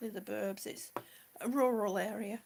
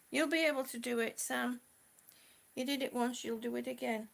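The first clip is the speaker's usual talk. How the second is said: ordinary talk, nothing marked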